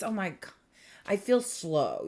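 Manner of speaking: usual speaking voice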